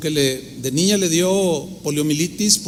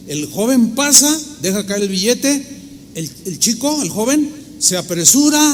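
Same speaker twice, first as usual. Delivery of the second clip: ordinary delivery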